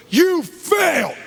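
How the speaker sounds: high, loud, slow accusing shout. Retold or imitated. Ex-client shoving cigarettes in his face, angry high shout. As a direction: yell it in his face, drawn out.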